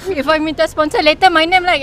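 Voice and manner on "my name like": usual speaking voice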